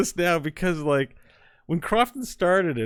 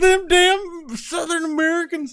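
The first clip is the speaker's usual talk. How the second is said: much higher, louder, slow hammed-up Southern yell; mock the Southern hosts in a big cartoon twang; 13 semitones higher, 7 dB louder, slower than usual. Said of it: "we're like".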